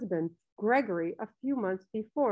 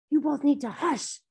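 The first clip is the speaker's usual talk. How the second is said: higher-pitched, urgent hushing; warning; 4 semitones higher than usual. A narrator's reading. Mother warns two boys giggling near the master's house, urgent warning. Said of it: "she warned".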